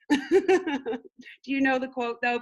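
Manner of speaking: high, giggling ask to the viewers; laughing question. Real, not acted. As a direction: ask the chat while laughing.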